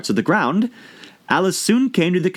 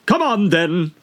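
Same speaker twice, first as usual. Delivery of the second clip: roaring queen; 10 semitones higher, slower than usual. A narrator's reading. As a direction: order the march. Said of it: "roared the queen".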